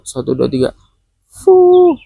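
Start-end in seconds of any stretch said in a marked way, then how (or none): 1.3-1.9 one hard puff of breath; blowing. Pretend. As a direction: blow out hard like on a candle. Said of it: "Lagi, yuk".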